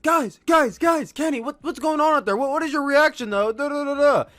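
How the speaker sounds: high, loud, pushy questions; eager interviewer. Retold or imitated. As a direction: chase them down for a quote. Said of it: "he's like".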